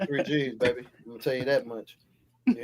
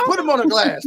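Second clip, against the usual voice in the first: mock cop shout; 10 semitones higher, 6 dB louder than usual. Playing the cop again.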